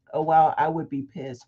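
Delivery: usual speaking voice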